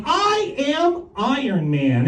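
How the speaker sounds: mock-heroic catchphrase, drawn out; Iron Man. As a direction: space the words out like a talking action figure. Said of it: "in the back of Iron Man".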